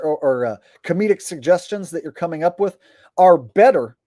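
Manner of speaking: ordinary talk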